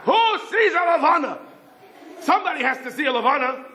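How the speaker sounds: high-pitched, pleading call; eager calling out. Retold or imitated. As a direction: appeal to everyone on the radio. Yossel calls out over radio.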